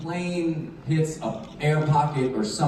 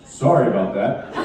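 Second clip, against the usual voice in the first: calm captain voice; 8 semitones lower than usual. Retold or imitated. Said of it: "from the captain, and he goes".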